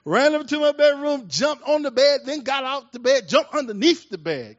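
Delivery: high-pitched, full-voiced dramatic retelling; fired-up preacher storytelling. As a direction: tell it like a preacher building to the climax.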